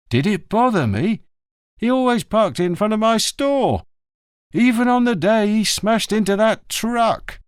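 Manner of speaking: can't believe he's asked; indignant greengrocer. A narrator's reading. Greengrocer answering the inspector, indignant.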